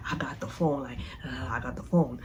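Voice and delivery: hushed, sneaky whisper to the friends; secret whisper. Retold or imitated. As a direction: whisper it so he can't hear. Brittany whispering she has phone.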